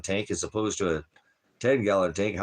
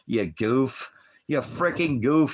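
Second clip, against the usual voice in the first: louder, higher mock scolding; teasing scold; 6 semitones higher, 6 dB louder, slower than usual. Real, not acted. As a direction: tease her loudly for being a goof. Teasing Sabrina over petting lionfish.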